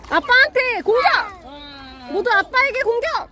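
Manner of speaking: high, excited battle cry; excited rallying call. Real, not acted. Cheering kids to attack dad.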